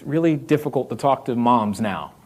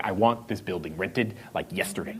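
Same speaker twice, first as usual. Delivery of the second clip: muttered wish; 9 semitones lower, 10 dB quieter than usual. Retold or imitated. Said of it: "'cause you was like".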